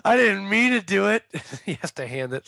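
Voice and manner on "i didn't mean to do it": higher, louder whiny plea; whining child. Retold or imitated. Siblings returning with the switch, whiny apology. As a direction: beg like a guilty kid. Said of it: "they come out and go".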